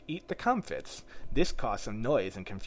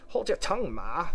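high, cheeky retort; a little snappish; 6 semitones higher, 4 dB louder than usual. A narrator's reading. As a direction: mildly snap back at a mother. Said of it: "a little snappishly".

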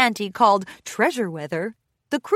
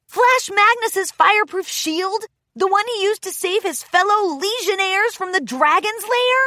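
high, loud eager fan; excited; 11 semitones higher, 4 dB louder than usual. Acted. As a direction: gush like a starstruck fan. Rainbow Dash explains excitedly.

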